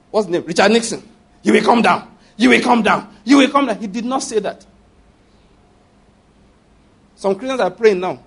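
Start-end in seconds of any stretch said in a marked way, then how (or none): 1.4-3.8 mock aggressive prayer. Mock aggressive prayer against Nixon.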